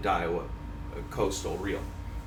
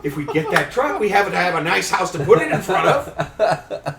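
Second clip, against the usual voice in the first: much higher, louder, laughing through the words; laughing pitch to wife; 6 semitones higher, 7 dB louder than usual. Retold or imitated. Husband laughing as he explains the house plan to his wife. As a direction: sell the silly logic while cracking up. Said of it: "I go".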